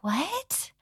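rising, bewildered "What?" with a soft voice; confused What. Retold or imitated. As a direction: ask "What?" as if lost in a foreign language. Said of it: "And I was like".

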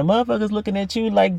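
ordinary delivery

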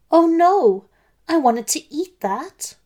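high, loud "oh no" easing off; dismayed wail. Acted. Wolf losing his cookie in the tea, dismayed. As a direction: cry out in dismay, then lament.

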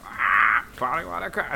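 imitating a parrot squawk. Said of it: "And he's like".

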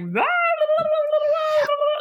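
high-pitched babbled roar of many voices; imitated roaring. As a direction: imitate an incoherent high-pitched din of arguing. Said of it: "like, everybody roaring".

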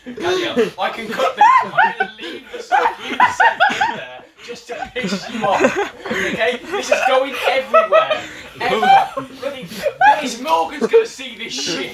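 loud laughing tease. Real, not acted. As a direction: cackle through a gleeful threat. Teasing threat, laughing loudly.

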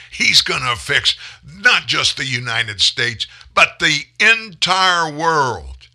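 slow, loud, high mock-grand finish; sarcastic grandiose. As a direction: stretch it out sarcastically.

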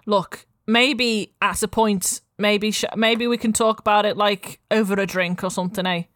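a light Scouse market-stall mum; lightly voiced Liverpool accent, friendly. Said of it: "while I'm doing a Liverpool accent".

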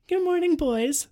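high, slow sing-out; drawn-out high, teasing hello; winking greeting. Acted. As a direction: greet them knowingly through a smile. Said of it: "smiled Calendar, winking".

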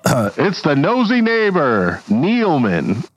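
high, slow announcer voice; old Hollywood announcer. Acted. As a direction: unveil the neighbor dramatically. Announcer introduces neighbor.

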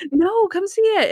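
high-pitched imitated coaxing; insistent Natalie. Acts Natalie insisting Monk look.

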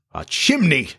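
high, surprised exclamation; exclaimed. A narrator's reading. Two pirates discover the hidden chimney, exclaiming in surprise. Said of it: "they both exclaimed".

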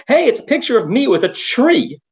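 poking fun at a proud tourist; mock tourist enthusiasm. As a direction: play an easily thrilled sightseer. Mocks tourists posing with tree.

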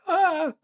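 high-pitched imitated "ah" of alarm; mock frightened cry. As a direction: recoil with a high, comic wail. Mimics fans recoiling from him.

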